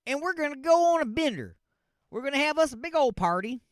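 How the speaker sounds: high-pitched put-on Minnesota accent; mock Minnesota accent. Man plans nursing home breakout party, mock Minnesota accent. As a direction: play the party planner in a hammed-up Minnesota accent. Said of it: "I don't know why I was doing that accent".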